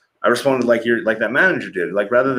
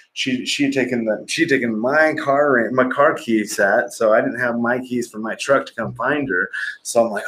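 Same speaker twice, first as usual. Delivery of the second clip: ordinary talk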